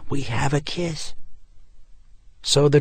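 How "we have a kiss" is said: lightly voiced girl, hushed and eager; a child's thrilled hush from the stairs; excited child whisper. Daughter reports parents kissing, mild excited whisper. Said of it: "Kelsey's excited whisper".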